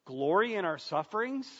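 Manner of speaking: "Glory in our sufferings?" as a rising question; incredulous echo. Acted. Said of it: "Am I reading this right?".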